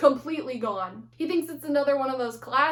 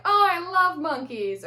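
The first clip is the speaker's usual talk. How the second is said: naive Larry; 5 semitones higher, 5 dB louder than usual. Retold or imitated. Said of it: "naive comments from Larry like".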